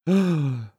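short high gasp; startled intake of breath. A narrator's reading. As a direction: gasp in shock. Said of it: "ахнул товарищ Коротков".

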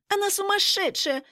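high-pitched indignant squeak; squealing. A narrator's reading. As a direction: squeal it like an outraged blonde. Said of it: "запищала блондинка".

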